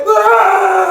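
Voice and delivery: high shouted ah of pain; injured boy calling out from the shower; pained cry. Retold or imitated.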